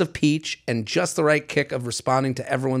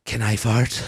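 lower, mock sheepish ask; hammed-up guy asking; 4 semitones lower, 3 dB quieter than usual. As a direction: ham up the guy's polite question.

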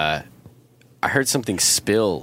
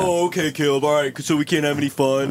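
higher, grumbling okay-Dad voice; mock compliance; 6 semitones higher than usual. Friends answering the one they call Dad, mock compliance. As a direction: give in sarcastically like scolded kids. Friends mocking his scolding.

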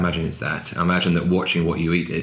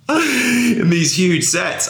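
words breaking up into high laughter; laughing while talking; 13 semitones higher, much slower than usual. Real, not acted. Actor recalling two Englishmen's ropey accents, laughing through it. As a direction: crack up helplessly through the phrase.

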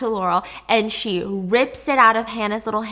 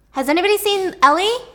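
calling out; 8 semitones higher, 7 dB louder than usual. Retold or imitated. Mom calling out through house.

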